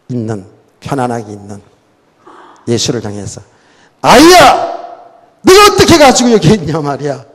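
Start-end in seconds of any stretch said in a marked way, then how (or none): 4.0-7.2 put-on high, loud reproach of a child; scolding. Mary finds her missing son in the temple, scolding. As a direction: call out sharply, then scold from a raised pitch. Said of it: "막 그러면서 책망하잖아요".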